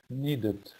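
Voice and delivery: ordinary talk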